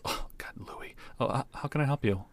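flustered whispered aside. Acted. Waitress Mary, flustered, whispers about the rude barman. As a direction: whisper it, caught off guard. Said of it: "Mary comes up a little flabbergasted".

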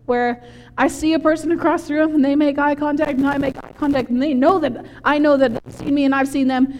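normal talking voice

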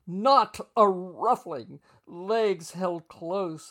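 loud and slow, every word drawn out; loud slow gushing. Retold or imitated. Boss gushing over the first lady's posture, loud and slow. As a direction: lay it on thick, slowly, for the whole room.

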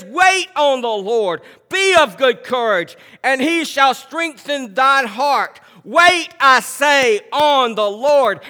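slow, loud scripture reading. Acted. Pastor reading verse fourteen aloud, slow and loud. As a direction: lean on every word of the verse.

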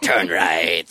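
low crying shout of a creepy GPS; creepy monkey GPS voice. Pretend. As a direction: wail the turn like a haunted navigator. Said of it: "monkey navigation".

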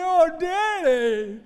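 very high, stretched mock-crying wail; begging daughter. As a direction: whine it out like a pleading little girl. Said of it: "she begged me".

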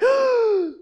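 loud, high mock-crying gasp; tearful gasp. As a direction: gasp like a panicked, sobbing recruit. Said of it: "you're crying, and you're like".

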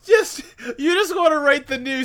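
real laughter through the words, high-pitched; laughing. Real, not acted. Teasing co-host about fan fiction, laughing. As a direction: tease through a fit of laughter.

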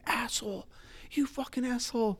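high-pitched imitated yell at no extra volume; mock scream. Older gentleman in line yelling at the man ahead, mock screaming. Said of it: "he just starts pointing over the people in front of him at me and screaming".

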